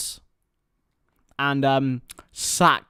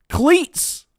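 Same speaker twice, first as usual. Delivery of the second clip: mocking the word; 11 semitones higher, 5 dB louder than usual. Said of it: "cleats is a stupid word".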